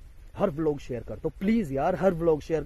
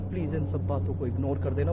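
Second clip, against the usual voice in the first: normal talking voice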